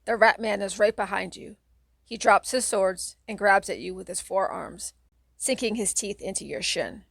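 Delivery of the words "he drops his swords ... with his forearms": usual speaking voice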